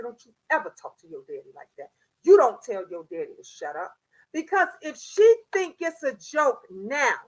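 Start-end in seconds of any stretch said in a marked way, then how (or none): 0.0-3.9 low, fast stern warning; stern grandma. Retold or imitated. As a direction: lay down the law. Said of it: "I said".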